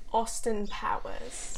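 a hint of a British accent; lightly put-on British pronunciation. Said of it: "did your British accent there".

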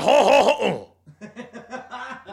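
high, loud French "ho ho ho"; cartoon Frenchman's hearty laugh; hammed-up French chortle; mock French laugh.

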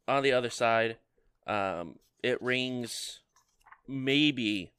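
normal talking voice

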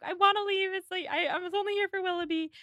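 high-pitched retold plea to leave; mock distressed outburst. Acting out upset Marianne's outburst.

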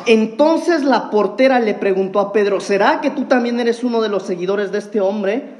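preacher reading the Gospel aloud with force; scripture reading. Acted. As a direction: proclaim the passage to the congregation. Reads the scripture passage aloud.

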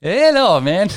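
high, loud put-on Jamaican greeting; mock Jamaican accent. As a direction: imitate the butcher in a hammed-up Jamaican accent. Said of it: "He sounds Jamaican".

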